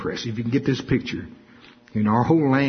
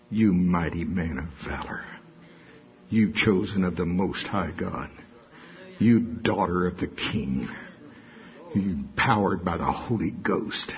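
lower, softer affirming voice; calm affirmation; 5 semitones lower, 4 dB quieter than usual. Acted. Preacher tells believer who they are, low and calm. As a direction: speak their identity low and calm.

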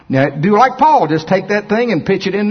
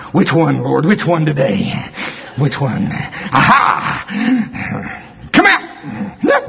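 acted eager believer; 2 semitones lower, much slower than usual. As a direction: act out hunting the sick. Acted Walmart healer scene.

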